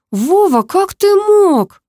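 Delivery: high, drawn-out cry of betrayal; hurt reproach. A narrator's reading. Bride confronts Vova at the wedding house, high hurt reproach. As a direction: raise the voice in stretched, wounded disbelief.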